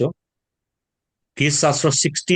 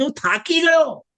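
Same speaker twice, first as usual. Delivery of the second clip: weary David; 8 semitones higher than usual. Retold or imitated. Preacher voices David worn out and pleading. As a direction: cry out like a worn-out psalmist. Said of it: "આ દાઉદ કે".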